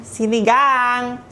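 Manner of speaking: sinigang stretched slow and high; drawn-out sinigang. Shown as a demonstration. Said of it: "gang, gustong sinigang".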